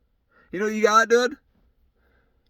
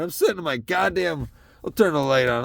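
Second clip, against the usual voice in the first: ordinary talk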